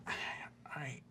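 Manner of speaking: ordinary talk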